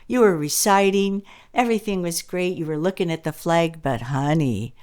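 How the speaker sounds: lightly voiced gentle teacher coaxing a child; a hint of a sweet teacher talking to Johnny. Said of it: "So later she goes".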